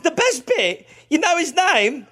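giddy excitement. Real, not acted. Telling the cohost the clown's name, squealing with glee. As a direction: builds to a gleeful squeal. Building excitement about Barrels.

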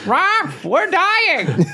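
parrot squawk. Pretend. Caged parrot on the porch squawking crude lines, high parrot squawk.

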